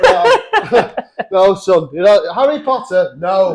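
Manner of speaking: mock-scolding dad brushing off Harry Potter; strict communist dad. Dad refusing Harry Potter to his son, mock stern.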